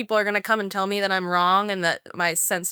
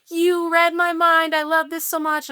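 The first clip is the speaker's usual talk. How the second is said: imitated delighted client; 10 semitones higher, 5 dB louder than usual. Happy client praises the designer's work, mock-delighted gushing.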